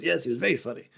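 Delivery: soft, understated royal impression; Charles. Charles agrees he was very funny, in a soft royal voice. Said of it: "And Charles goes".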